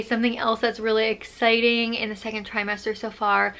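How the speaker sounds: ordinary talk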